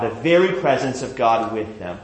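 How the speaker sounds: ordinary talk, nothing marked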